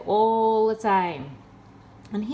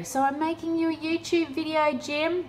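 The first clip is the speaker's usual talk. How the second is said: sarcastic taunt; 7 semitones higher than usual. Real, not acted. Sarcastic address to scammer Jim.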